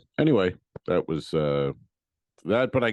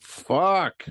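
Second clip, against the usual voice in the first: loud, high exasperated outburst; retold cry of frustration; frustrated curse; 10 semitones higher, 5 dB louder than usual. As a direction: let the swear word burst out.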